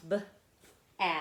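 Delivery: "b, a" as separate letter sounds; each sound of "b, a" on its own. Shown as a demonstration. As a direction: sound out "b", pause, then short "a". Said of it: "makes it a short vowel sound".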